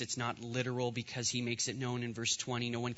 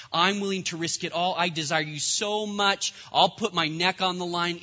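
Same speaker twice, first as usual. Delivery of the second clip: louder, higher, fervent declaration; impassioned plea; 5 semitones higher, 7 dB louder than usual. Acted. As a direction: plead boldly with God, raised voice. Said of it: "But Moses is like".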